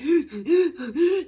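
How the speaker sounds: loud, high, crying gasp for air; panicked gasp. Retold or imitated. Retelling a panic attack on the phone to dad, a loud crying gasp. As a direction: gasp like you can't breathe. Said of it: "And I'll be like".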